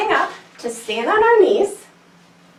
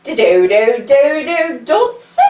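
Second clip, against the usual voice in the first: dodo call; 4 dB louder, slower than usual. Shown as a demonstration. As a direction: chant it like a proud little bird. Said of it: "and say".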